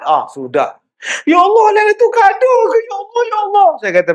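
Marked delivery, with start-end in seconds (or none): 1.3-3.7 high, fast, wailing "Ya Allah" in a sobbing voice; crying aunties. Retold or imitated. Aunties at his pondok weeping over the bent banana trees, imitated sobbing cry. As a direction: imitate the weeping old ladies, much higher. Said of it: "Makcik-makcik pondok saya menangis".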